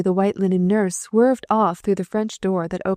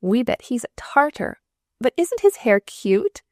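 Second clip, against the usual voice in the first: put-on giggly young nurses; 5 semitones higher than usual. A narrator's reading. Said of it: "giggled the new nurses".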